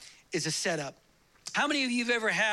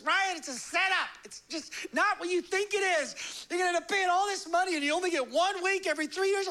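higher, urgent warning; wife warns him in a higher voice; mock warning wife; 7 semitones higher than usual. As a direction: talk sense into her husband.

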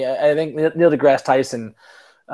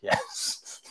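lower, soft, fading into a whisper; mock hushed yes yes yes; hushed agreement; 9 semitones lower, 13 dB quieter, much slower than usual. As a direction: breathe the yeses out. Friends agreeing in a retold chat.

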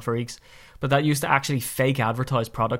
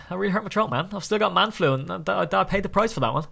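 ordinary talk, nothing marked

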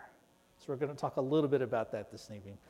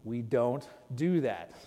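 lower, slow calm correction; gentle fatherly correction; 5 semitones lower than usual. Retold or imitated. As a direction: soothe and correct a small son. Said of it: "I say".

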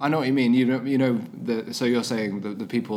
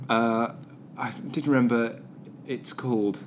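ordinary delivery

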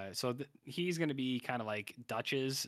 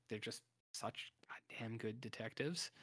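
hushed, voiceless aside; whispered admiration; 8 dB quieter than usual. Real, not acted. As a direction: drop to a whisper, as if sharing a secret. Admiring aside about detectives.

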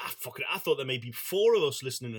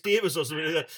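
usual speaking voice

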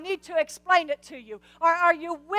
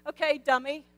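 mock teasing. Teasing Zacharias in a voice.

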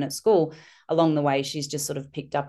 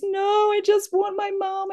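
put-on crying girl wanting mum. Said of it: "And she's".

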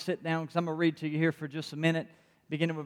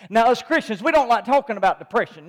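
loud, driving Sunday sermon that keeps building; rousing preacher calling out to the church; full-force preaching; 3 semitones higher, 5 dB louder than usual. Acted. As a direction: preach it hard and lift the room.

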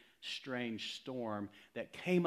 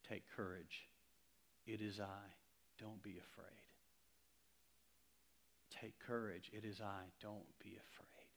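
much softer, fading into a whisper; hushed reassurance; 4 semitones lower, 17 dB quieter than usual. Acted. Jesus comforting listeners in the storm, hushed reassuring whisper. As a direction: breathe the words gently to the listener, ending in a whisper.

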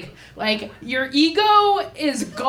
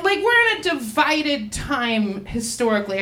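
usual speaking voice

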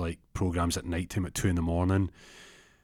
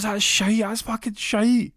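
very high, loud dismissal; hammed-up shrieked disgust; outraged scorn; 4 dB louder, slower than usual. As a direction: drag out the last words in disgust. Scorns rival's chat-up line.

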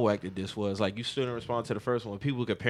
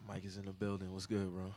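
sexy voice; 5 semitones lower, 7 dB quieter than usual. Acted. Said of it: "why Mike put on a sexy voice".

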